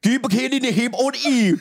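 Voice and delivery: rising and falling gibberish recitation; gibberish Bible reading. Pretend. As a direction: recite scripture as rubbery nonsense syllables. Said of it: "Can you do Genesis one?".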